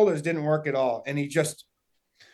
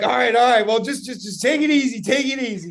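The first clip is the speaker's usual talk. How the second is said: higher and louder, urging everyone to take it easy; excited calming; 9 semitones higher, 6 dB louder than usual. Retold or imitated. Hunter calming eager friends on the blood trail, excited.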